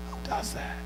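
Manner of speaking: very soft, whispered question; hushed disbelief. Acted. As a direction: drop to a near whisper in disbelief. Same question repeated in a hush.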